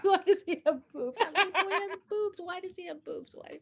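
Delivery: put-on high, loud, bewildered child's voice; a little boy blurting out his confusion; upset little boy. Kid asking about her boobs, high and bewildered.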